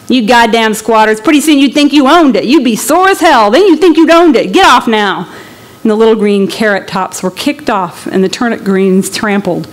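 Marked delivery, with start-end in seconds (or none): 0.1-5.3 louder, sneering lawman voice; a deputy running a squatter off; angry deputy. Acted.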